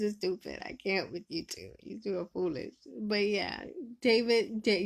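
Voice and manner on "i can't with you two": normal talking voice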